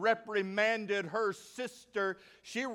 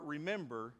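usual speaking voice

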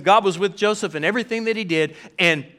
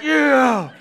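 higher, mock-hearty approving yeah; cheering dads; 9 semitones higher than usual. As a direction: egg him on like proud dads. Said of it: "all the dads are like".

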